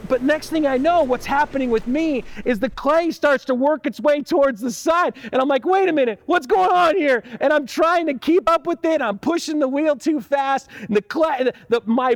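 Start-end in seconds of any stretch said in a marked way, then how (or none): 5.6-7.2 high, louder sudden alarm. Retold or imitated. Pastor reliving the clay sliding sideways, high startled panic. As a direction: panic as the clay runs away.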